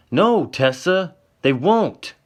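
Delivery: slow, high angry yell. Acted. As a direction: shout her down, dragging the words. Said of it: "he yells at her".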